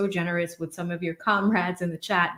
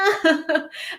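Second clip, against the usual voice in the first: laugh; 9 semitones higher, 4 dB louder than usual. Real, not acted. Laughing after the joke.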